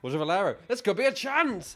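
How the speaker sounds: rising thrill of a near goal; sudden commentator excitement; excited commentary. Real, not acted. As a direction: voice jumps high on the chance.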